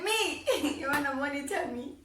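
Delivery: high, laughing "me?"; laughing disbelief. Retold or imitated. Narrator mocking her parents' offer, laughing and high. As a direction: scoff at the idea, laughing.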